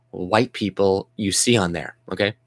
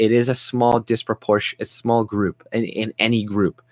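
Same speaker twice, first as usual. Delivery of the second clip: normal talking voice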